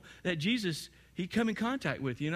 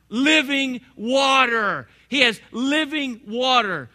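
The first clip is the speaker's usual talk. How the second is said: slow, loud and higher, each word drawn out; preaching at full force; 7 semitones higher, 10 dB louder, slower than usual. Acted. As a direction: proclaim living water like a revelation. Preaching climax.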